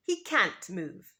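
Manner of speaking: usual speaking voice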